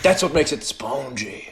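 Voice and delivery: slow French accent imitation. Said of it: "with such pride after you said that one".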